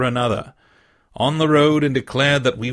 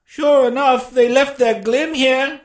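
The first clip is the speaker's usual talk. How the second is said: high, loud pirate voice; fellow at window; 12 semitones higher, 6 dB louder, slower than usual. A narrator's reading. Man at the window to gang below, high call. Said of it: "said the fellow from the window".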